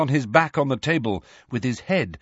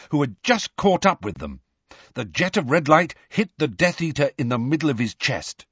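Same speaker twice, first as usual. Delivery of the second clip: ordinary delivery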